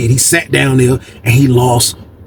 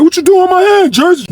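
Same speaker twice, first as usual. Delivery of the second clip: 1 indignant outcry. Retold or imitated. Angry loser at card table.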